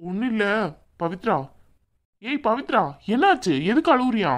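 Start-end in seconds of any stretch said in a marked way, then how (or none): 2.2-4.3 high, worried questioning; alarmed concern. Acted. Brother sees his sister crying, alarmed high-pitched questions. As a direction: raise the pitch in sudden concern.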